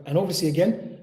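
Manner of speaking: ordinary talk, nothing marked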